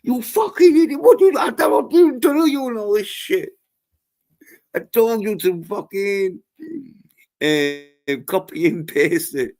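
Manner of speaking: high, loud furious yelling at a victim; angry scammer shout. Retold or imitated. Scammer yelling at the man who botched the transfer, high furious shout. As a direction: screech at him like an enraged scammer. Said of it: "the scammer's going".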